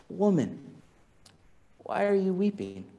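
soft, tender asking; gentle question. Acted. Jesus gently asking the weeping Mary, soft and tender. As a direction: ask it softly, with compassion.

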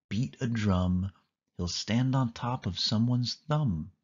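ordinary delivery, nothing marked

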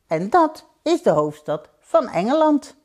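put-on high, proud child's voice; little boy. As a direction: announce it like a small boy showing off. Boy continues after narration tag. (trots jongetje met hoge stem)

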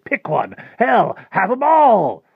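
building to a high, loud cry; exuberant offer. Acted. Rictus pushing yapping pups on Harvey, exuberant. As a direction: huckster giving it all away, soaring and loud. Tempter urging boy to take pups.

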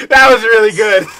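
loud, delighted outburst; excited praise. Real, not acted. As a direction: shout your approval mid-laugh. Cracking up at friend's joke.

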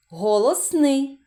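ordinary talk, nothing marked

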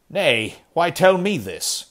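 high, loud, panicky question; a scared old man pleading; frightened old miser. Acted. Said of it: "he muttered".

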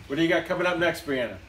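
ordinary talk

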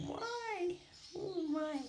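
high squeaky coo. Real, not acted. Cooing at bird.

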